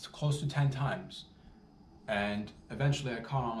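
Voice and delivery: usual speaking voice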